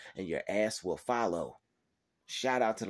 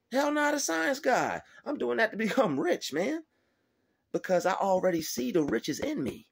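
fired-up rant; 12 semitones higher than usual. Real, not acted. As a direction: preach your own hustle at full heat. Fired-up rant about his grind.

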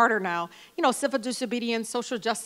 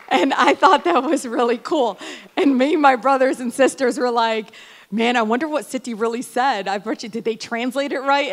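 excited retelling; 3 semitones higher, 8 dB louder than usual. Real, not acted. Telling the crowd about grandmother's curse, louder excited delight. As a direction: share the thrill with the audience.

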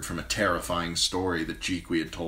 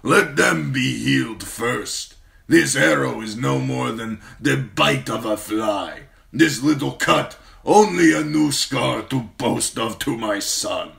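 slow, loud warlord refusal; stubborn khal; 7 semitones higher, 8 dB louder than usual. Acted. Wounded khal stubbornly refuses healing, slow loud. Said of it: "Khal Drogo said stubbornly".

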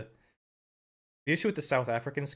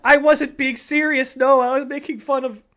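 real loud defensive plea; loud protest; 8 semitones higher, 11 dB louder than usual. Real, not acted. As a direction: protest you were only joking. Defending himself to a donor.

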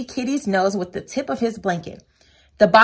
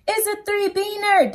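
high excited declaration; high proud announcement; declared; 10 semitones higher than usual. A narrator's reading. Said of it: "declared the peritos".

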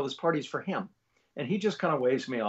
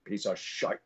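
slow, louder Scottish disdain; Sean Connery; 6 dB louder than usual. Retold or imitated. Sean Connery dismissing his own film, scornful impression. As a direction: draw it out in a scornful Scottish burr. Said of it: "And he goes".

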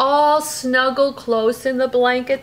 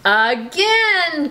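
eager, louder Again; excited cry; 7 semitones higher, 6 dB louder than usual. Acted. Sam begging his mama to do it again. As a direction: beg for more like a happy cub. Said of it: "cried Sam".

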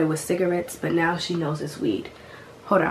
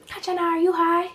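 very high mock-mom voice; imitating mom; 12 semitones higher, faster than usual. Mom asks daughter again, imitated high accusing voice. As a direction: question it high, like an accusing mother.

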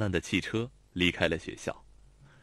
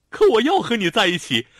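tearful shout; 11 semitones higher, 10 dB louder than usual. A narrator's reading. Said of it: "她红着眼叫喊".

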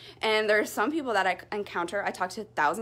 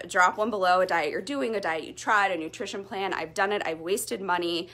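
ordinary delivery

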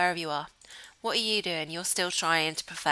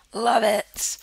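voice cracking; 10 dB louder than usual. Real, not acted. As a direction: voice cracks on a loud, excited word. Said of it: "my voice cracked then".

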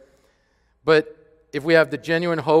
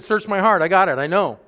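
confident psalmist; 6 semitones higher, 4 dB louder than usual. Retold or imitated. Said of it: "He said".

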